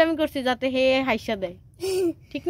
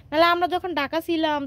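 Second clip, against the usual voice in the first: usual speaking voice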